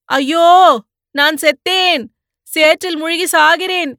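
high, loud cry for help from the mud; terrified shout as he sinks in quicksand; panicked shout. Acted. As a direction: yell desperately while sinking. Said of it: "என்று கத்தினான்".

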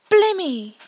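high, loud gasp of amazement; a twin stunned at meeting Harry; amazed exclamation. Acted. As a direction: blurt it out, astonished.

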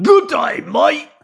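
high, hammed-up Aussie greeting; broad Australian accent. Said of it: "I put on a broad Australian accent".

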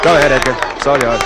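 loud call into the crowd noise; shouting over a crowd. Acted. Boss shouts to Edgar over the crowd. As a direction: push it over a roaring crowd.

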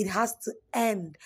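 ordinary talk, nothing marked

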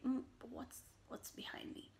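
hushed, wondering little voice; a small bee peeking over her shoulder; curious bee whispering. Acted. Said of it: "She's very curious".

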